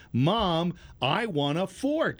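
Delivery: slow, high-pitched imitation of a toddler; little boy, loudly. Little nephew to mom at a restaurant, loudly. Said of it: "he's loudly saying".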